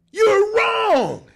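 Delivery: loud, high outburst; scolding shout. Acted. Comic yelling at an employee who is wrong. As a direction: yell it at the whiner.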